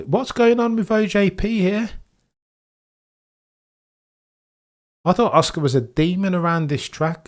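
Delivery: high, loud disbelief; can't believe what he sees; incredulous. Real, not acted. Shocked at Oscar's pace.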